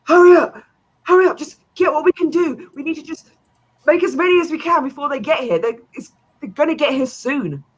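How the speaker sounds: high, loud, fast panicked urging; frantic merchant. Acted. Merchant urging the rat folk to hurry, high and frantic. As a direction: rush them, voice pitched high in panic. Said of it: "and is like".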